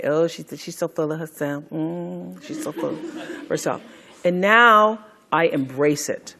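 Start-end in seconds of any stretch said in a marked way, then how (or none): 0.0-3.8 soft, sneering imitation of critics; catty mock sniff, hushed and disdainful; mocking gossip. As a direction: mimic the snide whisper of people judging her. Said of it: "people who say".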